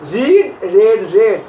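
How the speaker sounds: রিড with long ee, then রেড twice with short e; changed vowel. Shown as a demonstration. Teacher drilling three verb forms, changed vowel from রিড to রেড. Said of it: "উচ্চারণ আলাদা".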